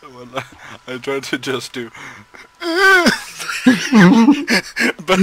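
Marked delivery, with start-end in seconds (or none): 2.0-5.0 loud high laughter. Real, not acted. Player cut off by his own laughing, loud high laughter.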